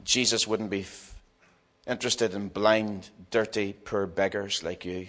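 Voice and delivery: low, quiet, cold dismissal; scornful put-down. Acted. Crowd telling the beggar Jesus won't care, quiet scorn. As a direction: dismiss him softly and low. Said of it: "they cried out".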